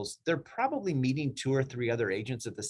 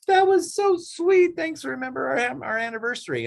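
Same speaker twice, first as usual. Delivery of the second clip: gushing grateful client; 12 semitones higher, 5 dB louder than usual. Retold or imitated. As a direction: swoon over the sweet gesture. Client's thank-you email.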